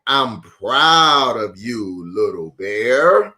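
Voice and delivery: slow, tender praise from a father. Acted. Father bear tells his sleepy cub, slowly and tenderly. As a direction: say it slowly and lovingly to your little one.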